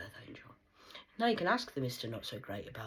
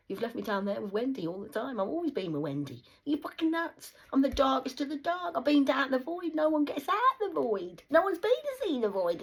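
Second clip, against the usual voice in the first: building from loud to a high ranting yell; climbing into a high, furious yell; rising shout; 10 semitones higher, 10 dB louder than usual. Retold or imitated. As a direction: shout it up into a frenzy.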